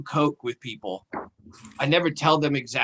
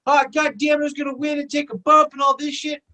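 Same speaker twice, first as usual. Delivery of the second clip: high, pitched-up panic over the lost bag; mock whine, much higher; frantic friend; 11 semitones higher, 3 dB louder than usual. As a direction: play the winner wailing about his ruined prize. Friend losing his mind.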